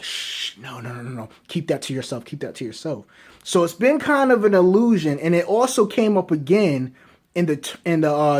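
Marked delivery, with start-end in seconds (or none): 0.0-3.1 hushed, low shushing aside; soft, hurried cover-up under the breath; retold hushing. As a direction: shush them quietly, keep it secret. Retold Beerus hushing Whis.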